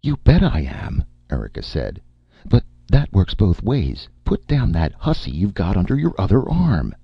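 indignant. A narrator's reading. Erika jealous, demanding.

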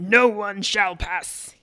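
loud, very drawn-out knight's proclamation; mock scream. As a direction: bellow it slowly, barring the way. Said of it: "he screams".

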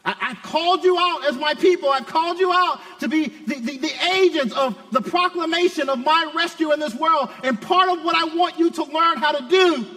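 high, urgent voice of God calling his people; full-force preaching. Acted. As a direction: proclaim it as a commission from the pulpit.

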